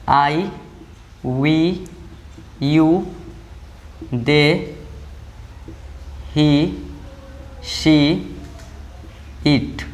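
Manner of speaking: each pronoun alone, followed by a pause; slow list dictation. Shown as a demonstration. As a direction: read out the list one item at a time for note-taking. Dictates list while writing. (एक-एक शब्द रुककर लिखवाते हुए)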